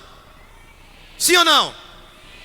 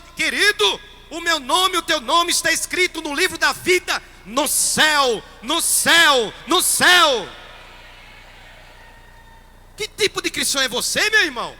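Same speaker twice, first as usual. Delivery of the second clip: high, loud fervent sermon voice; full-force preaching; 6 semitones higher, 5 dB louder than usual. Acted. Priest rouses his congregation against fear, preaching loudly. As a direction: preach it out to the whole church.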